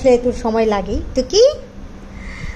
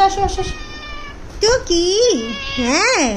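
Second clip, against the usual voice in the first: higher, coaxing sing-song to a cat; pet talk; 6 semitones higher than usual. Real, not acted. Soothing angry pet cat. (বিড়ালকে আদুরে গলায় শান্ত করা)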